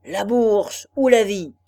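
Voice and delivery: loud booming robber voice; horrible cavernous voice. Acted. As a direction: roar it like a hollow brute. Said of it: "deux horribles voix caverneuses".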